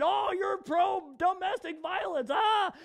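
high, slow, indignant mock yell; mock outraged shout. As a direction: parody an offended online complainer. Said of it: "people get all offended".

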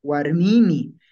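"Warmimi" with a long i before final mi; loud "Warmimi", said warmii-mi; stretched I. Shown as a demonstration. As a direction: hold the middle i of "Warmimi". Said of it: "están estirando".

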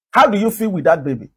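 usual speaking voice

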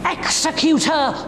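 high, commanding cry; sharp order. Acted. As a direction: order it as an enraged princess. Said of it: "ordered Salome".

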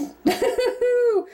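high delighted laugh; giddy laugh. Real, not acted. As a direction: burst into happy laughter. Laughs after finding diamond.

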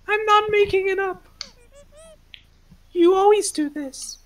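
put-on high, pleading teen voice; high whiny voice. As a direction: whine it up high like a hurt kid. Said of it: "you're just sitting here like".

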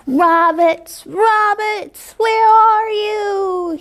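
put-on wolf boy howling for the rabbits. A narrator's reading. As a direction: howl it long and hungry. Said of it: "he howled".

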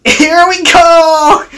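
high, loud troublesome truck; mock laughing cheer. A narrator's reading. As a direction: call it out gleefully, without real laughter. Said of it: "laughed the troublesome trucks".

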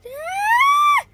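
loud, very high squeal; high-pitched scream. Real, not acted. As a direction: scream in game alarm. Hit during the fight.

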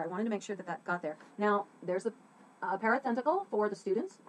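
ordinary talk

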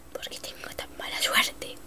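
breathy, hushed complaint; whispered lament. Real, not acted. Gamer after dying, whispering a lament. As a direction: whisper the bad luck.

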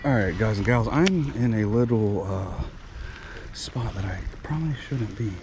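ordinary talk

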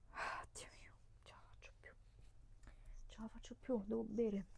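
drained and out of energy; exhausted whisper. Real, not acted. Vlogger tired mid-video, exhausted whisper.

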